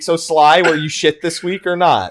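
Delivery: nagging mom. Acted. Mom calling her son up about his week, mock nagging. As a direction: raise the voice like a mom on her weekly call. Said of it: "She calls you up every week".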